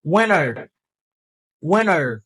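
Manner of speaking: "Winter" said as "WIN-er", no T; American pronunciation. Shown as a demonstration. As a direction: drop the T in "Winter", falling tune. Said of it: "li repete se winter". (di "Winter" san T, alameriken)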